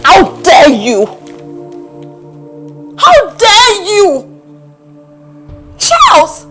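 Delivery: loud, slow, wounded outrage; indignant cry rising on the name; outraged confrontation. Acted. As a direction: hurl it at the one who betrayed you, word by word. Betrayed woman confronts Charles.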